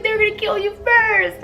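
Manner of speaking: put-on high, far-off frightened teen voice; frightened daughter. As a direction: mimic her panicked warning. Daughter's scared warning retold.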